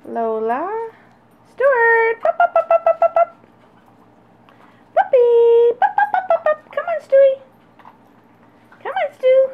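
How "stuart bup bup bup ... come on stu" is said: very high, loud sing-song calling to puppies; puppy calling voice. Real, not acted. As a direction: coax the pups with a squeaky high call.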